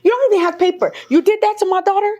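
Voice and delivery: high-pitched retold confrontation; going off. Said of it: "I'm going off".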